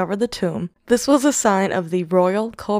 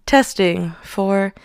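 normal talking voice

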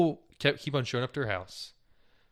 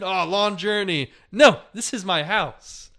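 much higher, louder, slow, insistent; mock doorstep argument; 6 semitones higher, 7 dB louder, slower than usual. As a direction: ham up the quarrel at the door. Retold argument at the door, each time.

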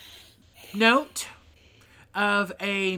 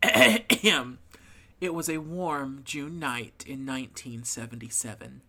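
announcer voice; 4 semitones lower than usual. Shown as a demonstration. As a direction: narrate like a radio announcer. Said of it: "announcer voice".

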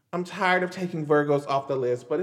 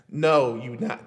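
normal talking voice

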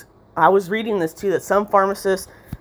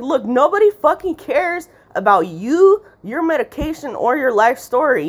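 pitched-up exasperated tirade; exasperated outburst; 8 semitones higher than usual. Real, not acted. Speaker's own exasperated outburst.

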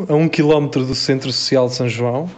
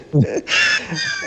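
high breathy laugh; 3 dB quieter than usual. Real, not acted. Laughing at the tractor joke.

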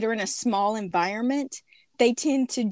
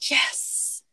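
whispering. Real, not acted. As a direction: whisper it back. Whispered reply.

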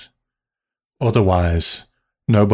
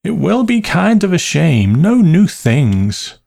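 vague aunt; 9 semitones higher, 3 dB louder than usual. A narrator's reading. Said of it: "a sign of her recurring vagueness".

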